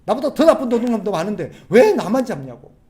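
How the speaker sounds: loud, high, aggrieved complaint; indignant anger. Acted. Caught thief blaming worse thieves, loudly indignant. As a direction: protest like a wronged culprit. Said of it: "잡히면 잘못했다고 용서를 구해야 될 건데 화를 내요".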